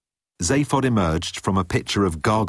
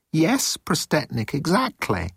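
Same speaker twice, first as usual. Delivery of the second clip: high, drawn-out agreement; nervous underling; 10 semitones higher than usual. A narrator's reading. Moan placates Prostetnik Jeltz, high and nervous. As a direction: agree anxiously, pitched up.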